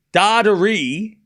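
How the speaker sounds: slow, separated "Da, da, re"; pronunciation option. Shown as a demonstration. Streamer recalls the option chat rejected, slow "Da, da, re". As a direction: sound out "Da, da, re" syllable by syllable. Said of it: "what was the last one?".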